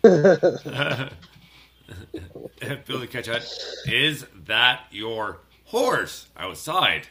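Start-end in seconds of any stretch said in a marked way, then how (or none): 3.9-7.1 slow, louder, each word spelled out; slow deliberate repeat. Shown as a demonstration. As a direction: let the title land slowly, word by word. Repeats title slowly for effect.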